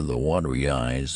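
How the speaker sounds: ordinary delivery, nothing marked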